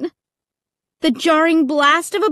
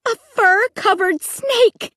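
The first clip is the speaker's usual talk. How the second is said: higher, slow cry of horror; frightened scream; 8 semitones higher than usual. A narrator's reading. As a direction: shriek at the snake, a touch held back. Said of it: "I screamed again".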